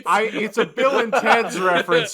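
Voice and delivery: high, loud, indignant outburst; loud exasperated. Real, not acted. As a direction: yell it in mock outrage. Defends a joke to co-host.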